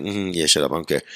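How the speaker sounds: low, soft mock dismissal; mumbled mock "shut up"; dismissive brush-off. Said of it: "that's his energy".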